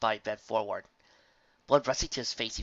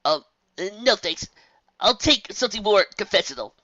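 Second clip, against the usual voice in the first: nervous refusal; 10 semitones higher, 9 dB louder than usual. A narrator's reading. Colt declines nervously.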